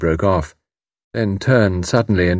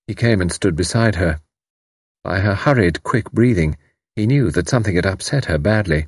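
ordinary delivery, nothing marked

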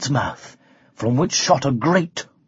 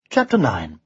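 ordinary delivery, nothing marked

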